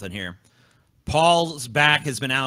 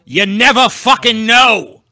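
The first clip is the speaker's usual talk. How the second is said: higher, louder, slow proclamation; mock dramatic yell; 8 semitones higher, 9 dB louder, slower than usual. Host proclaiming to the others, mock dramatic shout. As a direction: belt it out like a grand slogan.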